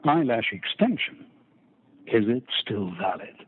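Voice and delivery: mock-solemn audiobook introduction, long pauses; dramatic reading. Narrator performing a pop singer's tweet on request, dramatic reading. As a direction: read it as weighty drama, unhurried and deliberate. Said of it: "do a dramatic reading of those tweets".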